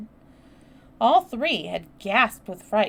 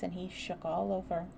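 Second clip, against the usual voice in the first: ordinary delivery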